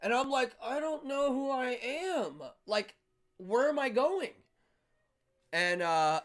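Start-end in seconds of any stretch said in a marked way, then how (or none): 0.6-4.3 slow, high drugged bewilderment; raised, drawn-out disoriented question; confused. Retold or imitated. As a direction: wail it slowly, totally lost. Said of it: "I'm confused".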